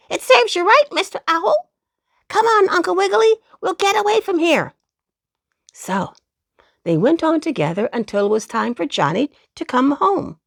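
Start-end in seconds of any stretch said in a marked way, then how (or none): 0.1-4.7 put-on high, quick cheeky boy voice; a gleeful kid crowing at the owl; squirrel boy. A narrator's reading. Squirrel boy taunting the owl, high and cheeky. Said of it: "called the squirrel boy".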